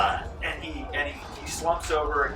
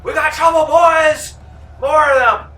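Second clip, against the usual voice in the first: high, loud warning cry; alarmed shout; 13 dB louder than usual. Acted. Bandits spot reinforcements and warn their gang, shouting. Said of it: "and go".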